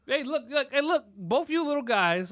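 ordinary delivery

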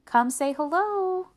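high, loud, drawn-out call. A narrator's reading. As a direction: mom summoning the kids, stretched out. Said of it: "Mom called".

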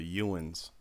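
Ewans said as yoo-unz, meaning you all; West Virginia Ewans. Retold or imitated. Imitating his buddy Slam's West Virginia Ewans. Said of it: "he would say".

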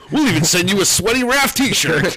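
high, loud pitchman voice; infomercial. Pretend. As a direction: oversell the free bonus. Continuing the infomercial bit.

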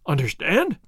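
puzzled query on "Understand", rising sharply at the end; "Understand" with a steep questioning rise. A narrator's reading. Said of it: "in a questioning tone".

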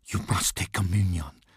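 urgent hissed whisper. A narrator's reading. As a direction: hiss it under your breath, urgently. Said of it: "hissed the priest".

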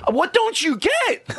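high, slow exasperated yell; incredulous shout. Retold or imitated. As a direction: shout it in disbelief at someone who just doesn't get it. Club owner scolding a chatty audience member.

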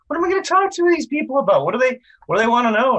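high, fast, panicky self-questioning; nervous inner voice. Retold or imitated. Actor mocking his first-convention panic, high and fast. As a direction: rattle it off high like a fretting first-timer.

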